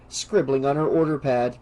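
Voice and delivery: ordinary delivery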